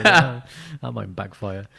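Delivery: ordinary talk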